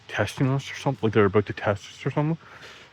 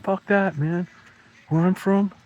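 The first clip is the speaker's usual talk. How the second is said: cocky comeback; 10 semitones higher, slower than usual. Retold or imitated. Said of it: "I was like".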